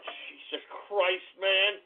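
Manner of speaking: ordinary delivery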